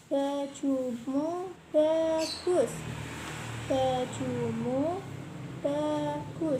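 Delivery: very slow, loud and clear; over enunciating. Shown as a demonstration. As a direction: stretch each word for the pupil. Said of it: "kakak dulu ya, disimak".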